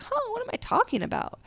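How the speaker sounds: high, fast, puzzled self-check; quick amused double take; mock surprise. Catching herself mid-scream, asking herself in high, fast surprise. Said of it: "both times I was like".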